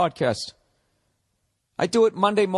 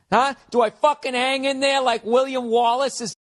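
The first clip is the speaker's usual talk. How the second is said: mock heroic; 8 semitones higher than usual.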